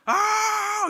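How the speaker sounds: high, loud imitated shriek; sudden scream. Student screaming suddenly in class, sudden imitated yell. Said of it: "갑자기 소리를 질러요".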